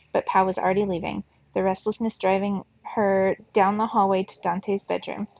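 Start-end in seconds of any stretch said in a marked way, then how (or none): none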